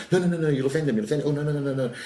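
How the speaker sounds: high, panicky string of protests; flustered, fretful refusal, imitated; freaking out. Events organiser warning the comedian he'll offend, imitated high panic. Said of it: "this woman freaks out".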